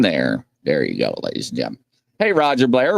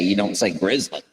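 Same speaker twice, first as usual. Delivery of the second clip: normal talking voice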